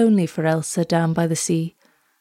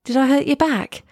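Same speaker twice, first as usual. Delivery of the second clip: higher, concerned question; crying out; 6 semitones higher than usual. A narrator's reading. Girl worries she hurt the turtle, high and concerned. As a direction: ask in a high, dismayed girl's voice. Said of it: "she cried".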